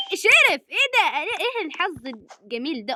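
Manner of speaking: squeaky high mock-Egyptian gasp of surprise. Gamer reacting to a new look, surprised Egyptian accent. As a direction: jump way up, amazed, Egyptian style. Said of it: "نتكلم باللهجة المصرية".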